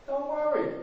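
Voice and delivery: high, airy, mock-casual relax; breezy reassurance. Moses telling the frightened people, breezy and high. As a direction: brush their fear off lightly. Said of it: "Moses says to the people".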